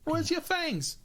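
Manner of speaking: high, slow starstruck question; high excited fan voice. Retold or imitated. Fan voice again.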